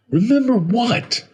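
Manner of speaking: high, loud, bewildered demand; loud panicked question; frantic cry. Acted. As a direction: cry out in confusion. Narrator cries out, frightened and confused.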